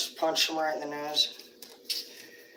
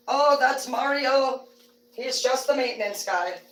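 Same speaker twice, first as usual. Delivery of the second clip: breezy cover story; 7 semitones higher than usual. Retold or imitated. Acted partner's breezy excuse.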